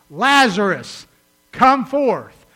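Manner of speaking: loud, drawn-out call of a name; commanding shout. Retold or imitated. Preacher voicing Jesus calling Lazarus from the tomb, loud shout. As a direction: call him out of the grave at full voice. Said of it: "In other words, he shouted".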